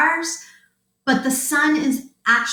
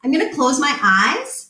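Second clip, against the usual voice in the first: ordinary delivery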